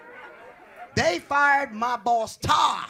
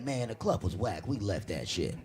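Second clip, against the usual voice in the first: low, softer friend voice; imitated friend; 14 semitones lower, 5 dB quieter than usual. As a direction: drop low for the dismissive friend. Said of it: "He was like".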